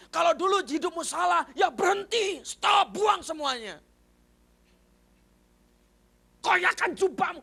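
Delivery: loud, high, urgent preaching; forceful exhortation. Real, not acted. Preacher urging listeners to discard old life, forceful shouting. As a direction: shout each command at the crowd.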